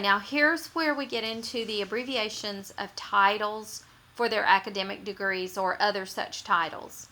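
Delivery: normal talking voice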